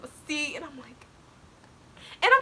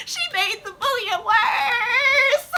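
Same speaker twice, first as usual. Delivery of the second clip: high, slow, loud wailing laugh; cry-laughing; 11 semitones higher, 6 dB louder, much slower than usual. Real, not acted. Blaming her mom for the minute phone, wailing through laughter.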